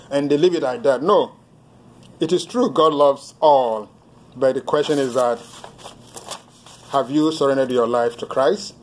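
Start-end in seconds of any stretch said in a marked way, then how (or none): none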